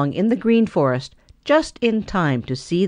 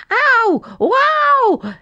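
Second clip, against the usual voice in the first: high, loud yelps of pain; yelling in pain; 4 dB louder than usual. A narrator's reading. Boxer dancing about, yelling over his paw. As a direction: yelp and hop with the sting. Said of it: "yelled Boxer".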